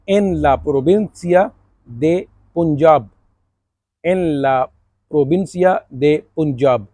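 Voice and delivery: Punjab said as Punkhab, with a throaty kh; slow Spanish with Punjab said Punkhab. Shown as a demonstration. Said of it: "पुनखाब तो परेशान नहीं होना".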